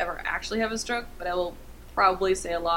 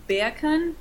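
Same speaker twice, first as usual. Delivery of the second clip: higher, put-on British "bacon"; mock British vowels; British accent; 6 semitones higher than usual. Shown as a demonstration. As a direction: demonstrate "bacon" as "beh-uh-kun" in British. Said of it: "say in a British accent".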